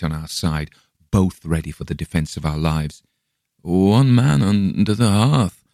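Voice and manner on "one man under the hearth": slow, eerie sleep-talking voice; dreamlike, slow, uncanny delivery; unnatural tone. A narrator's reading. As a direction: drag it out like someone speaking from a trance. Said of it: "in the same unnatural tone".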